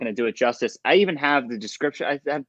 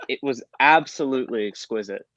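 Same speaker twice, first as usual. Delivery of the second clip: ordinary talk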